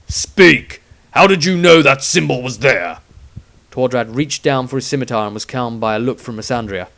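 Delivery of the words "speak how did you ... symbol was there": loud, barked demand; harsh interrogation. A narrator's reading. Witch hunter interrogating the wizard at swordpoint, loud harshness. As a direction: bark the order. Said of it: "pointed the sword at the wizard's throat".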